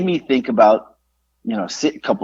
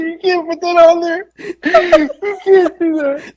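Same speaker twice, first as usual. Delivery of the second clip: much higher, crying with laughter; laughing; slower than usual. Real, not acted. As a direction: squeal the protest through real laughter. Host laughing in disbelief.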